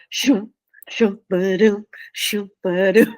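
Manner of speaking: mock shoo and ba-doom sounds, lightly voiced; playful sound effects; imitated whooshing noises. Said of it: "I could hear your brother".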